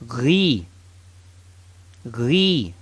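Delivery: "Ghi" with a gargled throat G; ghain gargle. Shown as a demonstration. Teacher models the gargled ghain sound. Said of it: "you get a ghi sound".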